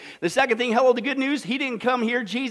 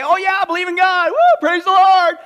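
high, louder mock hollow enthusiasm; mock glib praise; 8 semitones higher, 9 dB louder than usual. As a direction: parody a shallow believer. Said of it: "where people say".

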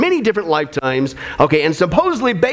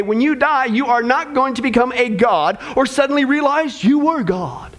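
usual speaking voice

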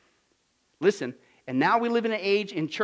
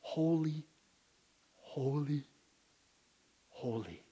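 each holy softer and lower, slow; reverent fading; 4 semitones lower, 9 dB quieter, slower than usual. Acted. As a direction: let holy fade into awe.

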